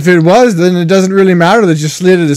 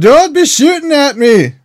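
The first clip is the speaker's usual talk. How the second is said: mild mock yell, pitched high; high-pitched, half-hearted yell; 8 semitones higher than usual. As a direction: yell up in protest, without full volume. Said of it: "I yell".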